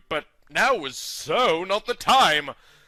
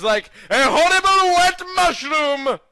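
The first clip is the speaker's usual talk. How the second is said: high, loud cry of disgust; hammed-up revulsion; disgusted outburst; 12 semitones higher, 9 dB louder than usual. A narrator's reading. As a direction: recoil at the image. Disgusted narrator.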